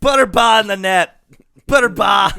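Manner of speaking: loud Boston goal call; Boston accent. Pretend. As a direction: belt it like a Boston fan. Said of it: "work in your Boston accent".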